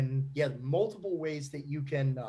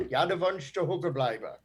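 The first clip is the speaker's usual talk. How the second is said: put-on Pennsylvania Dutch accent; 2 semitones higher than usual. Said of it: "are you talking via, uh, Pennsylvania Deutsch".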